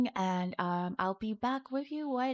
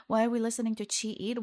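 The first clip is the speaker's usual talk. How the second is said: ordinary delivery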